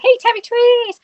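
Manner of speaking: playful greeting. Real, not acted. Host greeting a regular viewer, high sing-song call.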